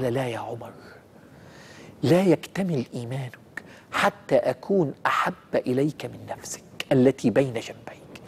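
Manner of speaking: soft, slow, fading into a whisper; gentle soft reply. Retold or imitated. فتخيل رد النبي.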